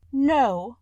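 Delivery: a hint of tearful protest; lightly weeping no. A narrator's reading. Beauty protests to the Beast, mildly tearful. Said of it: "said Beauty, weeping".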